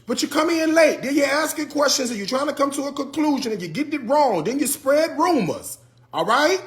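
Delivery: fed up, tear into them; real exasperation at viewers who came in late; exasperated rant. Real, not acted.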